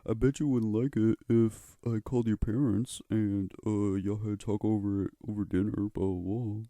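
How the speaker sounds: teacher threatening to call the parents; angry teacher. Retold or imitated. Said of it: "he was like".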